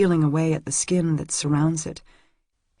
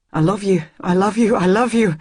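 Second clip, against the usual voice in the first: each repeat a little higher and louder; rising, louder declaration of love; building declaration; 3 semitones higher, 4 dB louder than usual. Acted. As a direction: pour it out, climbing.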